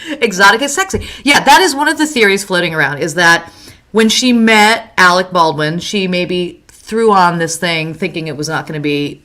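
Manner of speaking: ordinary talk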